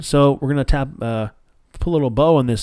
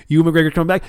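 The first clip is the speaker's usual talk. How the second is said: higher, eager announcement; excited; 5 semitones higher, faster than usual. Retold or imitated. Fan repeating the big news, getting excited. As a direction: build the excitement up. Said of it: "I'm like".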